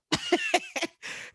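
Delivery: a squeaky laugh, way up high. Real, not acted. Laughing at the late, annoying producer, very high laugh. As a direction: let out a high-pitched laugh. Laughing after retold groan.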